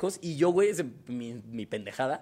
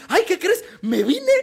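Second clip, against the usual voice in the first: high, loud, laughing mock confession; mock announcement; 13 semitones higher, 6 dB louder, much slower than usual. Imagining Tali announcing a surprise, high laughing voice. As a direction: blurt it out like silly surprise news. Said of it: "Tali va a decir".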